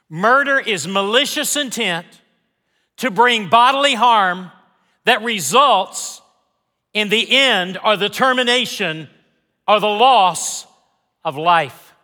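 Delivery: rising preacher's emphasis; preaching with force. Acted. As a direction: build the sermon's intensity.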